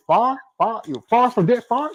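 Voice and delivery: mock Southerner saying "far", one syllable rhyming with car; Southern fire. Host imitating how Southerners say fire, high mock drawled "far".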